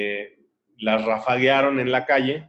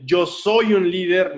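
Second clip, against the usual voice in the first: higher, slow, emphatic declaration; forceful affirmation; 6 semitones higher, 3 dB louder, slower than usual. Retold or imitated. Coach telling the girl to repeat, forceful declaration. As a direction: model a proud motivational slogan.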